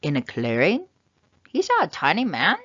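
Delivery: usual speaking voice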